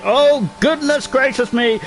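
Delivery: drawn-out, high "oh goodness gracious me"; dismayed exclamation. Real, not acted. Commentator lamenting Twang's crash, slow high dismay. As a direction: let the dismay stretch slowly.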